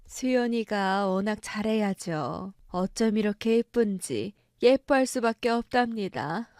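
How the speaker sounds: ordinary talk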